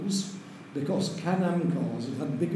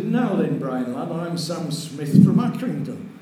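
put-on strong Lancashire accent; 6 dB louder, slower than usual. Chief steward introduces himself to the driver, strong Lancashire accent. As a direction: imitate a broad Lancashire race steward. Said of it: "in a strong Lancashire accent".

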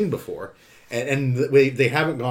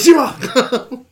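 Kojima! shouted high with a laugh; laughing shout of a name; 11 semitones higher, 7 dB louder, much slower than usual. Pretend. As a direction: blurt the name out through laughter. Mock name outburst, laughing.

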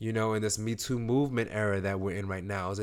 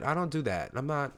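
ordinary talk, nothing marked

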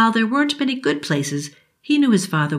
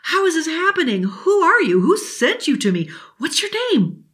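much higher, breathless questions; excited boy; 11 semitones higher than usual. Acted. Billy peppering the genie with questions, thrilled and high. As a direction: tumble out the questions in delight.

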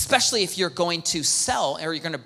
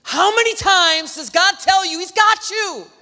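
much higher, louder, slow exasperated demand; frustrated outburst; 12 semitones higher, 7 dB louder than usual. Retold or imitated. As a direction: vent at Moses like you've lost patience. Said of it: "I'm just so frustrated".

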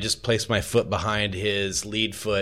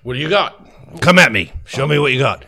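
loud, higher-pitched sparring taunt; punchy, forceful dare to a trainee; goading challenge; 5 semitones higher, 8 dB louder than usual. Acted. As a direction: egg them on to attack.

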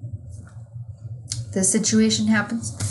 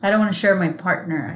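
ordinary delivery

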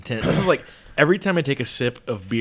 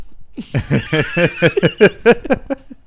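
high laugh; 6 semitones higher, 5 dB louder than usual. Real, not acted. Overwhelmed by the spicy heat.

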